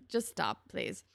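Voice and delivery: usual speaking voice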